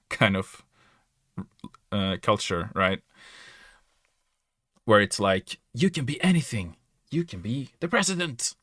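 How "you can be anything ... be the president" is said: a hint of a peppy American motivational voice, pitched higher; lightly parodying the can-do American dream pitch; mock-upbeat American, you can be anything; mock American optimist. Mocking American can-do culture.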